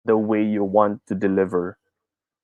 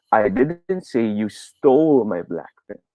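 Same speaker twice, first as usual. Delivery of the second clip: slow line, "stole" long and raised; stress on STOLE; slower than usual. Shown as a demonstration. Teacher showing it wasn't about stealing, stress on "stole". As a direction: lean on "stole" and hold it. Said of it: "Sa stole natin ilagay ang stress".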